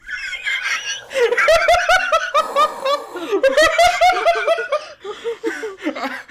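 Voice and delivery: big high burst of real laughter; cracking up at the twist; loud laughter. Real, not acted.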